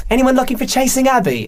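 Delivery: a receptionist's English accent, badly done; mock English accent from the receptionist. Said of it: "I'm bad with accents".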